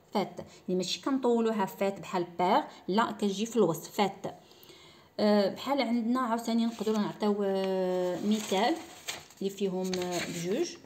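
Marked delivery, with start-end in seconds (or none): none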